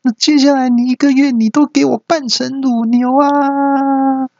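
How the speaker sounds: very high, stretched wife's tirade; hammed-up high-pitched outrage; acted angry yell. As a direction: play a furious wife shrieking an order, high and long. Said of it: "太太气得大叫".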